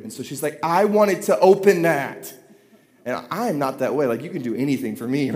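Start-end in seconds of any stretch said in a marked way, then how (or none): 0.6-2.3 put-on higher, louder, slow childish whine; whiny four-year-old. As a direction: stretch it out like a cranky toddler. Four-year-old whining quote.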